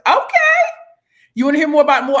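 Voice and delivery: high, loud delighted reply; gleeful high yelp; excited squeal. Real, not acted. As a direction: squeal then tease.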